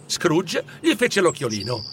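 usual speaking voice

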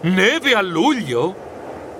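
perplexed, drawn-out disbelief; startled high start, then slow puzzlement; puzzled muttering. A narrator's reading. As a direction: question it slowly, baffled. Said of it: "borbottai perplesso".

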